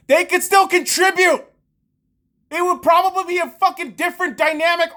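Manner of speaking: high, loud frustrated shout; shouting rant. Real, not acted. Fan yelling about traded players, loud and high. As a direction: yell it at the front office.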